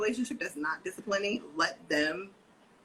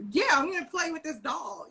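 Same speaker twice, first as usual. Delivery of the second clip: put-on higher, breezy kid voice; lightly voiced little girl; carefree child; 10 semitones higher than usual. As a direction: brush mom off to go play. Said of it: "And she's like".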